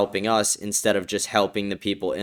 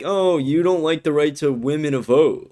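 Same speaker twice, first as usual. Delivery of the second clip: mocking a simp; 7 semitones higher, 5 dB louder than usual. Streamer parodies a commenter sucking up to women, high sneering mock. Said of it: "'cause you're like".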